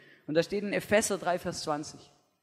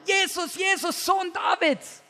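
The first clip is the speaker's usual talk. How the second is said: shouting again; 10 semitones higher, slower than usual. Acted. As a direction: cry out once more over the crowd. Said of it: "Schreit er nochmal".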